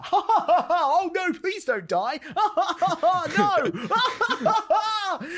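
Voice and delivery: high hysterical laughter swallowing the words; pleading through loud fake guffaws; laughing at death. Inept clacker begging the dying man to live, laughing hysterically. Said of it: "he's, like, laughing at this guy dying".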